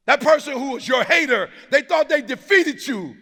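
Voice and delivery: high, loud, driving preacher shout; full-force preaching. Real, not acted. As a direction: rouse the whole room to stand.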